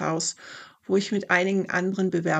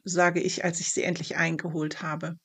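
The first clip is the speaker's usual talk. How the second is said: ordinary delivery, nothing marked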